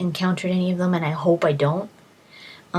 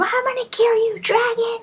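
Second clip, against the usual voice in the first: much higher, shrill-pitched mock battle cry; mock warrior scream. Follower screaming at the dragon, high hammed-up battle cry. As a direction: ham up the follower yelling at the dragon. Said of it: "You could hear her screaming at him".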